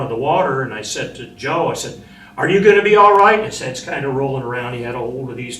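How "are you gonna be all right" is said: high, loud anxious call; shouted question. Retold or imitated. Marine shouting to Joe over the flood, high anxious call. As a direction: call over the rushing water to him.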